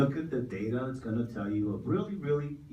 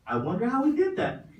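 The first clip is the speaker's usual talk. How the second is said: amazed imagined voice; 11 semitones higher, 4 dB louder than usual. Retold or imitated. Imagined people marvelling, laughed.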